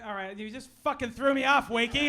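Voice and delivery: ordinary delivery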